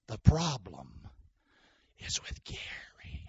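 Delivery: whispered aside, slow. Acted. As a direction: hush it like a secret. Aside joke.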